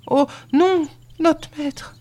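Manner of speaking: very high-pitched protest; pleading denial. A narrator's reading. Servant girl denying a second name, pleading.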